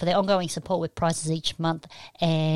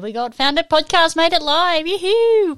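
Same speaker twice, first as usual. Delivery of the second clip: high, loud roll-call cheer; excited welcome; 9 semitones higher, 8 dB louder than usual. Real, not acted. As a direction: build into a high yoo-hoo whoop. Cheers a chatroom arrival.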